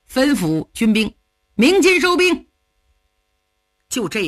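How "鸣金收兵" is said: high, loud order to the troops; command. Acted. Luo Cheng orders his soldiers to sound the retreat, loudly. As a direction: call the retreat crisply.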